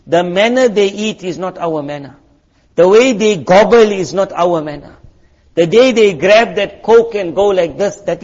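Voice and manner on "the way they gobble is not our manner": ordinary talk, nothing marked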